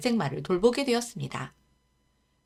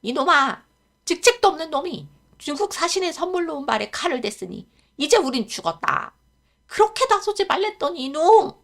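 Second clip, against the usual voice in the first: put-on higher, loud old man's scolding; 9 semitones higher, 7 dB louder than usual. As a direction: tear into the boy in panic. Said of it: "하라범에게 꾸지람을".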